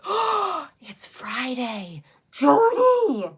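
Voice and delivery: gasp, then a loud high cry; real gasp of sudden dismay; shocked realization. Real, not acted. Suddenly realizes she forgot an appointment.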